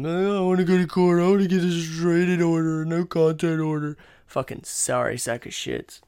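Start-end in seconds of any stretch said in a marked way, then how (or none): 0.0-4.0 higher, whiny mocking voice; mocking a snitch who runs to court, whiny; mock whiny victim. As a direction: sneer as a crybaby would. Mocking people who call law.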